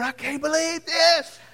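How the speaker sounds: high, slow, rueful laugh through the words; half-laughing at the mirror; laughing disbelief. Retold or imitated. Husband staring at his botched haircut, laughing disbelief. Looking at ruined hair.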